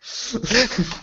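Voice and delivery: big high laugh; loud burst of laughter. Real, not acted. Celebrating getting professor title.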